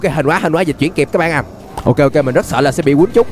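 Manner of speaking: normal talking voice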